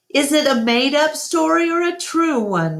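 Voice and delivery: a curious little elephant; eager child. A narrator's reading. Ruby asks Ivan if the story is true, eagerly childlike.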